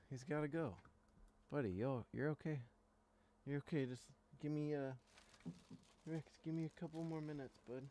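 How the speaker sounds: soft coaxing pet talk; talking to the dog. Real, not acted. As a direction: gently soothe the puppy.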